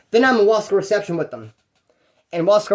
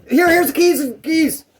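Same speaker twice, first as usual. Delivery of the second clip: very high, hurried-sounding retold yelp; mock panicked surrender; 10 semitones higher, 4 dB louder, slower than usual. Stabbed truck owner handing over keys, mock panic. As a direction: imitate a victim giving in at once.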